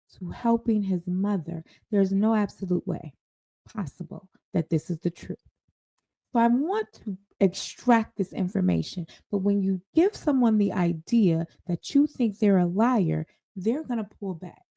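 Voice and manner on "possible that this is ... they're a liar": ordinary talk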